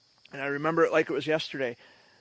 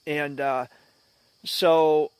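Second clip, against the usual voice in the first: ordinary delivery, nothing marked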